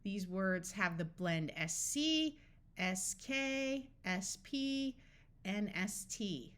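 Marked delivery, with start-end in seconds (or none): none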